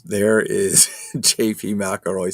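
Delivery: slowed words through laughter; kind gentle laugh. Real, not acted. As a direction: giggle through the name. Laughing while reading.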